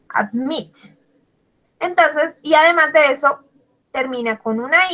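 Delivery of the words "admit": "Admit" stressed on the final "mit"; stress on last syllable. Shown as a demonstration. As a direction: put the weight of "Admit" on "mit". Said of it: "lleva la sílaba entonada en la última". ("Admit" con el acento en la última sílaba, "mit")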